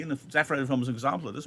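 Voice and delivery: normal talking voice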